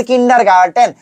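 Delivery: "কিন্ডারগার্টেন" with a hard t in the last syllable; "কিন্ডারগার্টেন" pronounced kin-dar-gar-TEN; ends in hard TEN. Shown as a demonstration. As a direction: say "কিন্ডারগার্টেন" ending in a crisp "ten". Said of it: "গার্ডেন না রে ভাই".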